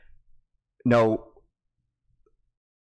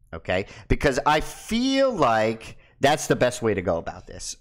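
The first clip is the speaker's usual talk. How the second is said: ordinary delivery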